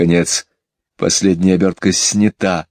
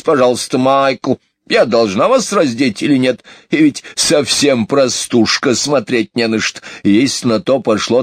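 higher, huffy nagging; fussy grumbling housekeeper; 6 semitones higher than usual. Acted. Housekeeper undresses children while grumbling, high and huffy. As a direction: scold and gossip in one stream. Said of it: "отдуваясь".

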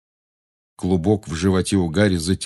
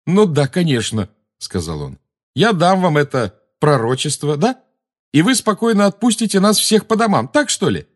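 high, loud mocking disbelief; sarcastic scoff; 9 semitones higher, 4 dB louder than usual. A narrator's reading. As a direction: scoff at the offer. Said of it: "ответить смехом".

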